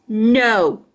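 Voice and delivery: a short loud refusal; shouted no. A narrator's reading. Jim refusing the girl, loud. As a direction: shout it at the pest. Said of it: "shouted that meanie boy".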